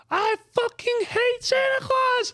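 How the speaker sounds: screaming little brother, very high; mock tantrum screech, way up high; kid's tantrum. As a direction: shriek it like a furious kid. Brother's tantrum acted out in story.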